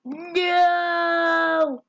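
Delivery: loud, high, drawn-out no; long wailed no. Real, not acted. Neighbor reacting to the name, long loud wail. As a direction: cry out one long protesting no.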